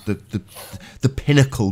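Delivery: halting stammer on "the"; stuttering. Real, not acted. Co-host building up to the pinnacle moment, stuttering. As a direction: stumble over the word before getting it out. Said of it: "I'm stuttering 'cause it's like".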